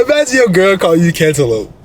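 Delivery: usual speaking voice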